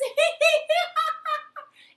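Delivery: high, loud happy laughing; high laughter. Acted. Everyone laughing together, high joyful laughter. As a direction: laugh with joy. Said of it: "Alice was laughing".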